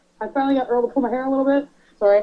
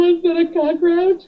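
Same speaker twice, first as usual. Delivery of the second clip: high, loud, drawn-out mock alarm; retold dread; 7 semitones higher, 4 dB louder than usual. Wife asking husband about a bug beside her, alarmed. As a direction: stretch out the frightened guess.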